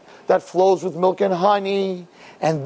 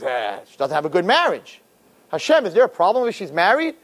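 normal talking voice

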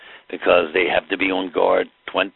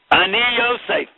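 high, loud, ringing declaration of identity; dramatic proclamation; 4 dB louder than usual. Acted. Yosef revealing himself to his brothers, high ringing proclamation. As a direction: proclaim it like a king unmasking himself.

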